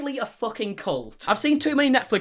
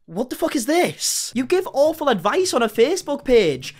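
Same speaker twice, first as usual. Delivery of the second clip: rising outraged disbelief, pitched up and louder; 7 semitones higher, 3 dB louder than usual. Real, not acted. Reacting to her war speech with outraged disbelief. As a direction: let your disbelief boil over, higher and louder.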